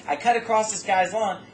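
usual speaking voice

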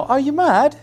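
put-on a light British accent on the question. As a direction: imitate his British wife lightly. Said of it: "speak with a British accent".

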